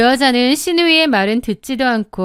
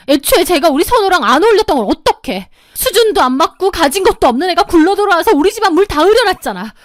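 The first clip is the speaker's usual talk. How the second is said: shouting back defiantly; 6 semitones higher than usual. A narrator's reading. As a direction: turn the blame around and shout. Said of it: "적반하장으로 소리를 지르기 시작했습니다". (뻔뻔하게 소리 지르며 따지는 시누이)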